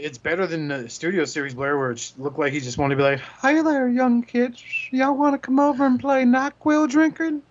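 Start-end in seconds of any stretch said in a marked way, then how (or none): 3.2-7.4 high creepy lure voice. Retold or imitated. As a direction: coax kids with a creepy high voice. Voicing a creepy toy figure.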